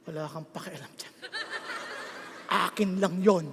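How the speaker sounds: low, very soft mock retort; hushed private brush-off. Preacher guarding his vote from askers, hushed brush-off. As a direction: keep it to yourself, quiet and low.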